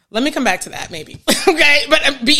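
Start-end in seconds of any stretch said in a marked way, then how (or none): 1.4-2.4 bursting into a laugh then a higher, louder okay; real laugh. Real, not acted. Therapist laughs at her own slip before resuming her point. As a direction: laugh it off and regroup. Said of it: "I just said it".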